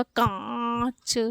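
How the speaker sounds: कांच with very long nasal आ, separate च; nasalised vowel demo. Shown as a demonstration. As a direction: stretch कांच through mouth and nose. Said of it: "तो ये उच्चारित होगा".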